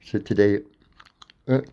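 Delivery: usual speaking voice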